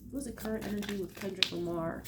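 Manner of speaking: soft, hushed asking of the cards; quiet invocation. Real, not acted. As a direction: ask the spirits gently, like a prayer.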